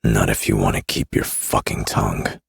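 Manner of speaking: low menacing growl. A narrator's reading. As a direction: growl the threat. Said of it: "I growled".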